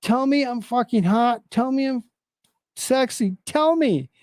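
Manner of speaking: high, demanding mock yell; hammed-up pleading at the guards; mock scream. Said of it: "screams the lady".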